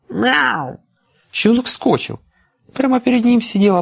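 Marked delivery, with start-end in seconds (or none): 0.1-0.8 high mewing meow; cat meow. A narrator's reading. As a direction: mew like a kitten. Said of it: "вдруг услышал". (кошачье мяуканье)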